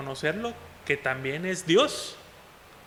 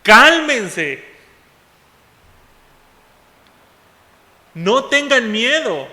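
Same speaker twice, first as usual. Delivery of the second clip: much louder, higher slow order; commanding shout; 6 semitones higher, 8 dB louder, slower than usual. Shown as a demonstration. Preacher booming Jesus' command to frightened disciples, loud and slow. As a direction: boom it out as a command, slowly. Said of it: "Es una orden".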